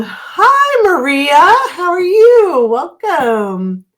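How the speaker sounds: high, loud sing-out welcome; excited greeting. Real, not acted. Host welcoming Maria to the chat, excited greeting. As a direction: greet brightly, pitch shooting way up.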